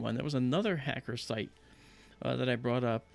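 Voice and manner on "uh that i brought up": usual speaking voice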